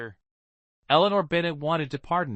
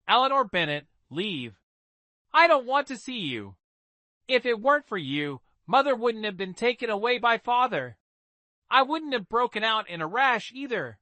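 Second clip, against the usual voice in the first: higher, sharp voice of an angry younger sister; resentful younger sister; 8 semitones higher than usual. Acted. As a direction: accuse her sister, voice pitched up.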